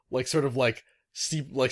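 ordinary talk

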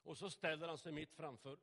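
ordinary talk, nothing marked

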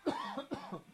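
coughing on a stuck potato chip; harsh real choking cough. Real, not acted. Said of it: "I'm choking on a potato chip".

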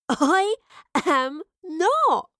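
high, loud mock indignation; playful protest. A narrator's reading. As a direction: protest loudly at being teased. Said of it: "Astrid chuckled and leapt at him".

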